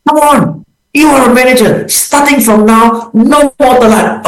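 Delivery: a leader scolding a late manager, mildly angry; angry boss demo. Shown as a demonstration. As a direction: tell the late manager off firmly but fairly. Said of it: "So I can be very angry".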